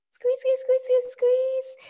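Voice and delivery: quick, very high-pitched squeeze chant; high squeezing cue. Real, not acted. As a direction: push it up high and loud, urging the squeeze. Cueing a shoulder squeeze.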